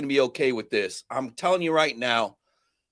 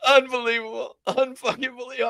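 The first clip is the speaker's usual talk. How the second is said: amazed disbelief; 7 semitones higher than usual. Real, not acted. Reacting to cat carrier story.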